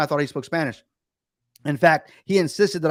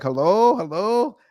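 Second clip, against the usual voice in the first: higher, questioning phone hello; calling for attention; 6 semitones higher, slower than usual. Retold or imitated. Phone agent cutting into father and son's talk, calling for attention. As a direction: call down the line to see who's there.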